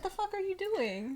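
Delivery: high, slow startled snap; half-laughing what the hell; mock outrage at a hair grab; startled quote. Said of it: "I said".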